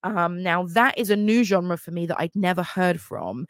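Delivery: ordinary delivery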